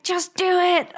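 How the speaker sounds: high mock-insistent father; insistent dad. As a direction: push it like a stubborn dad.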